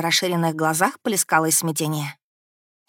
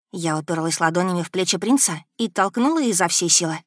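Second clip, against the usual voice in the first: plain reading voice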